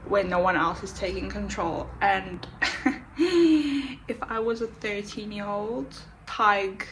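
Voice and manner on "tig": usual speaking voice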